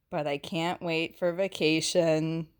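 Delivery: read-aloud punchline; teacher reading the final line; story reading. A narrator's reading. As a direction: deliver the twist line lightly. Reads the book's last line.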